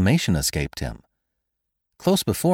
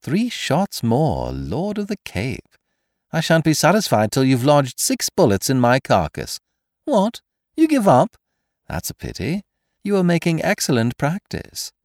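high, lively mock encouragement; taunting stranger, gleeful; 7 semitones higher than usual. A narrator's reading. Stranger daring Kesselbach to keep firing, taunting. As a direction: egg him on, making sport of him.

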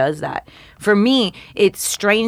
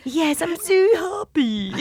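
high, slow mock British voice; delighted British accent, hammed up; put-on British accent; 12 semitones higher, slower than usual. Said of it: "you put an accent to it".

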